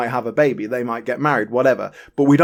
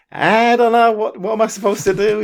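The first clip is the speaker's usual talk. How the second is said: higher-pitched, imitated bewildered protest; mock helplessness; 9 semitones higher, 4 dB louder than usual. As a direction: plead cluelessness in a raised voice, half laughing. Voices Jason's helpless look.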